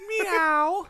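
high, drawn-out cat meow. Pretend. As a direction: imitate a little mascot cat. Imitates station's cat mascot.

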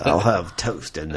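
slow, low mock-British order; attempted British accent. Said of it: "try to do a British accent".

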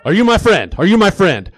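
loud, high, insistent question; worked-up, pressing challenge; heated challenge. Acted. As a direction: demand it of your buddy, nearly shouting. Same question, now escalating.

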